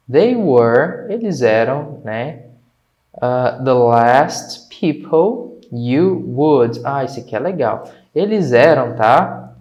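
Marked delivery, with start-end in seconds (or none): 3.6-6.8 slow, separated words; half-speed model reading; slow teacher read-aloud. Shown as a demonstration. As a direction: read it out word by word. Teacher reads text slowly for learners.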